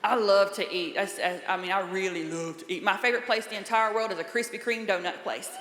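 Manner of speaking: ordinary talk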